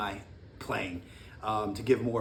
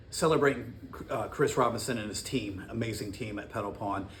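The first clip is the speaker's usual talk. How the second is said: ordinary talk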